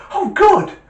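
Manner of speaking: very high, loud bunny squeal; gleeful squeaky rabbit, at full volume; delighted joke rabbit. Acted. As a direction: burst out with the cheeky punchline. Rabbit's triumphant punchline.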